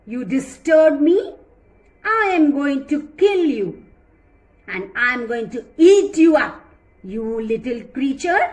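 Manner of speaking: loud threatening lion voice; angry lion. Acted. Lion threatens to kill and eat the mouse, loud and angry. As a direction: threaten to eat him up, booming.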